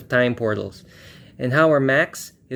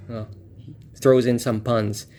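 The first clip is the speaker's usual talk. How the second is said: ordinary talk, nothing marked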